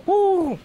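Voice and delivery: loud high "woo"; excited whoop. Retold or imitated. Exclaiming over the act in his story.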